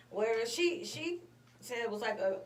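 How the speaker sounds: ordinary talk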